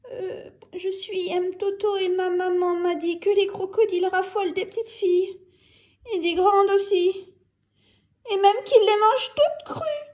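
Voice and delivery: put-on high, tearful little girl voice; a hint of crying child; trembling girl. A narrator's reading. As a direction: speak through leftover fear.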